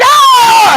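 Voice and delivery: sudden high yelp of pain; startled yelp. Retold or imitated. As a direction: yelp like something just bit your leg. Hagrid bitten on the leg.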